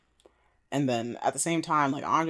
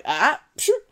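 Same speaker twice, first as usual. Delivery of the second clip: mock gasp; 11 semitones higher, 4 dB louder than usual. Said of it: "But she was like".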